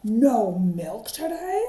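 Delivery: loud, slow dismayed cry; cried out. Acted. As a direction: wail it in dismay. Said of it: "cried Farmer Brown".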